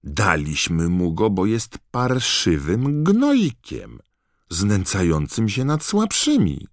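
loud, high, drawn-out retort; heated self-defence; indignant protest. A narrator's reading. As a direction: spit out the justification slowly, rising in pitch. Brothers indignantly defending their prank to father.

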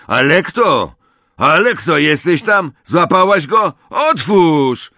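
loud, high calls through a door; urgent shouting. A narrator's reading. Brother shouts for his sister through the locked door, urgent. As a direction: call out demandingly to be let in.

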